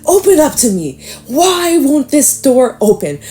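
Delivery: high-pitched pleading frustration; exasperated, high and insistent; mock frustrated yell. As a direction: play the panther begging it to open up. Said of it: "It almost looks like the panther's yelling at the oyster".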